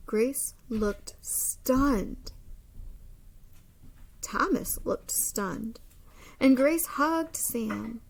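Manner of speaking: expressive storybook read-aloud; lively picture-book narration; reading to children. Acted. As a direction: read it like story time for a class.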